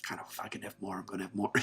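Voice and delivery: soft, offhand mock reply; hushed aside. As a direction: drop the volume as if murmuring it. Imitates casual reply, voice dropped.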